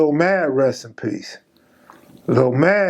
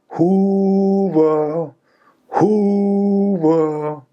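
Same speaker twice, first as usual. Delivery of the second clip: drawn-out, higher called-out gang name; yelled gang call; 4 semitones higher, much slower than usual. Retold or imitated. Hoovers calling out to the rival gang, drawn-out mock yell. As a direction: call it across the tier, stretched out. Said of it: "the Hoovers is yelling".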